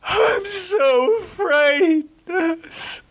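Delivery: high, slow crying confession; Geoffrey admits his fear to the nurse; tearful fear. Acted. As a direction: cry it out, terrified.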